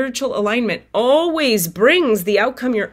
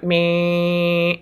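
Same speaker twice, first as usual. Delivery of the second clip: a low, put-on groan of defeat; a sagging sigh-like meh as she sinks back; 3 semitones lower than usual. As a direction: slump and let out a tired meh. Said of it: "I went".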